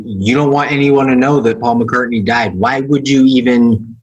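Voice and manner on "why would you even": ordinary talk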